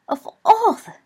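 very high, loud, broken-off exclamation; aghast outrage. A narrator's reading. Professor lost for words at Remus, outraged. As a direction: splutter high with indignation, then break off.

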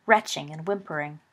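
usual speaking voice

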